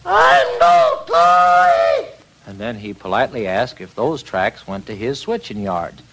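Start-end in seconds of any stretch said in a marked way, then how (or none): 0.0-2.1 very high, very slow squeaky protest; shrill drawn-out engine voice; offended little engine. Acted. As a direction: insist on it, drawing each word out high. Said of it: "he didn't like this".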